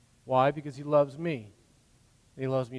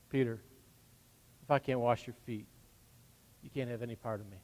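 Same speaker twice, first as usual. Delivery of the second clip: soft, low and patient, fading quieter; gentle and patient; 4 semitones lower, 6 dB quieter, faster than usual. Retold or imitated. Jesus answering Peter's refusal, soft and patient. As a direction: speak it softly like a patient teacher. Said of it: "gentle and patient".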